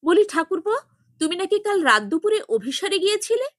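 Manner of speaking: sister-in-law ambushes him, high, loud and fast; storming attack. A narrator's reading. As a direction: pounce on him with the question, fast. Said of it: "ঝটিকা আক্রমণের ভঙ্গিতে বলে".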